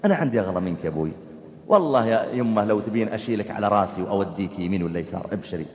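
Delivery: very low, soft, tender words; gentle loving speech. Shown as a demonstration. Son answering his parents gently, low and soft. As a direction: speak to your parents with tenderness. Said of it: "قولا كريما".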